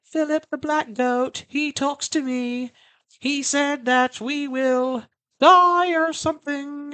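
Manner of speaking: put-on high, slow eerie child's chant; high creepy child voice; creepy little girl singsong. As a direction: croon it like a spooky little kid. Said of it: "singing weird fucking songs".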